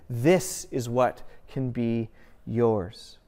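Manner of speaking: ordinary talk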